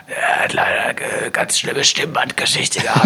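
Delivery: whispered, breathy rasp; whispering. Retold or imitated. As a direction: imitate a ruined voice. Imitiert heiseren Falkner-Kursleiter. (flüsternd)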